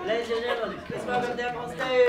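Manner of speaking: loud showman's announcement; announcer voice. Acted. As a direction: present them like a ringmaster. Introduces volunteers to audience.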